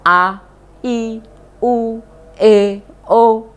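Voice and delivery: A, I, U, E, O as separate, sustained vowels; vowel sound demo. Shown as a demonstration. Teacher showing pupils language sounds, A, I, U, E, O pronounced separately. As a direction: sound out A, I, U, E, O one by one. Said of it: "seperti bunyi".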